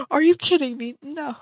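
gasp, then a loud disbelieving cry; shocked gasp and yell. Real, not acted. Streamer reacting to a sudden loss, gasping shout. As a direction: caught off guard and furious.

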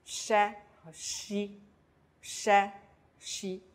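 sh as 'sheh', shi as 'shee'; sh and shi hushed, lips forward; sh syllables. Shown as a demonstration. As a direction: slowly model sh (sheh) and shi (shee). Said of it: "发出擦音sh的音".

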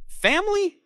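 loud, very high outraged question; high outraged burst; spat out. A narrator's reading. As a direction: spit it out in disbelief, voice leaping up. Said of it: "I spat".

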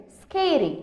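usual speaking voice

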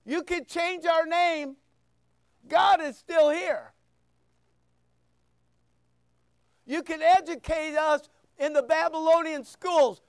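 preaching at full force, rising. Acted. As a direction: preach it hard to the congregation.

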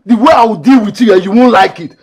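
high, loud threat; angry warning. Acted. Renewed threat in a quarrel.

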